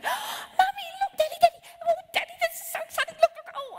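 put-on very high, loud, gasping child squeals; excited little girls. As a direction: imitate little kids bursting with excitement. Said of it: "little girls are jumping up and down".

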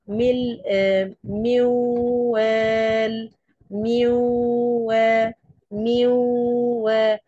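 "ميوال" stretched very slowly, the نون gone and only nasal ghunna held; drawn-out nasal merge. Shown as a demonstration. Teacher demonstrating partial idgham in "ميوال" to students. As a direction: hum "ميوا" long through the nose with no نون. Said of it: "في صوت نون سامعينه؟".

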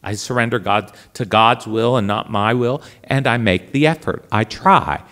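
ordinary talk

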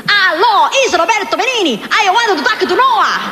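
excitable Italian caller, high and emphatic; Roberto Benigni. Retold or imitated. Italian director calling the singer out of the blue, excited impression. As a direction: gush down the phone with a thick Italian accent. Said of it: "That's the way he talks".

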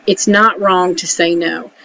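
normal talking voice

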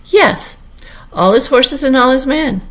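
high, loud, proud assertion; boastful Humpty Dumpty. A narrator's reading. Humpty Dumpty confirming the king's promise, boastfully. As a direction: ring it out high and emphatic.

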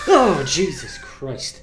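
slow, loud, high shocked exclamation; real fright from a jump scare; startled. Real, not acted. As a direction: gamer jumping at a sudden scare.

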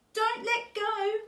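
high, loud, drawn-out plea; begging. A narrator's reading. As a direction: beg like a clinging child. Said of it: "Otto begged".

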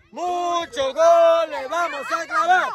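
pregón agudo y alargado; porra animada. Real, not acted. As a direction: corear estirando las sílabas. Porra del equipo. (porra lenta en tono alto)

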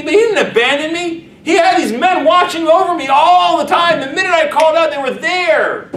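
loud, high fervent outpouring; remorseful prince. Acted. Bruised prince realising his father protected him, fervent loud outpouring. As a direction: pour out the realisation with rising fervor. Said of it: "it's like".

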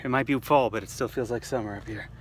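ordinary delivery

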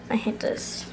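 frustrated whisper. Real, not acted. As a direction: whisper it under breath.

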